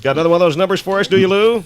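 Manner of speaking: high and fast eager question. Real, not acted. Host eagerly asking Lou for another number. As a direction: rattle it off brightly. Host teasing cohost, upbeat.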